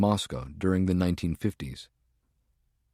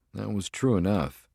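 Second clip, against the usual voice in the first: ordinary delivery, nothing marked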